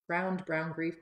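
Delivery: "Browned brown beef" tumbling out jumbled and quick; a garbled first try at "Browned brown beef"; tongue slip. Real, not acted. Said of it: "I always feel like I say that backwards".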